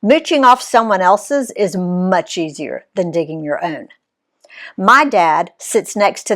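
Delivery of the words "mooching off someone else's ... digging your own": ordinary delivery, nothing marked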